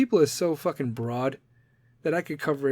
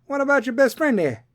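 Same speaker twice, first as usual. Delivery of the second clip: bartender; 9 semitones higher, 5 dB louder than usual. Acted. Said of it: "the bartender says".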